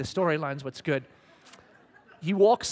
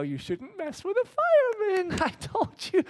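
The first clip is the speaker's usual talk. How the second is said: much higher, slow and loud; gleeful mock warning; 6 dB louder, slower than usual. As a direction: tease like a gleeful fan. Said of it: "you already go".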